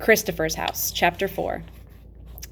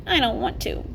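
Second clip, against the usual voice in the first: put-on higher, childish whine; babyish voice; 6 semitones higher than usual. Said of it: "I made my voice sound like a little babyish".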